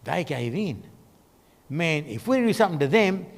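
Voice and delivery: low, hushed dawning alarm; alarmed inner thought. Acted. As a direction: think it aloud softly, as a worried onlooker. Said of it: "starting to freak out, thinking".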